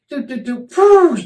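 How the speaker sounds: sung fall sound effect ending in a loud high phew; mimicked fall sounds. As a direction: act out a tumble with vocal sound effects. Acting out her fall.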